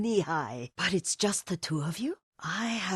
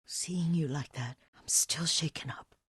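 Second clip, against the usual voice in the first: ordinary talk, nothing marked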